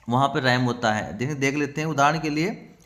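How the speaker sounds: normal talking voice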